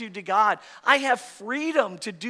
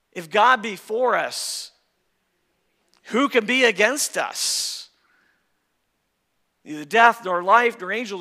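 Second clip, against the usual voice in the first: louder, building preaching of scripture; full-force preaching; 4 dB louder than usual. Acted. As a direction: proclaim it, rising to a peak. Preaching Romans eight at full force.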